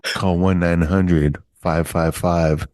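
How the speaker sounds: low hotline announcer, drawing out the number; late-night sex ad. Retold or imitated. Host parodies a one nine hundred number ad, sultry announcer voice. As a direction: imitate a late-night phone-line pitch. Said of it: "remember they had those late night sex ads".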